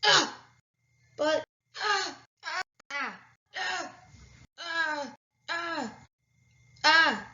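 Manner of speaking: short high yelps in a row; high squeaky yelps. Acted. Character cries out repeatedly.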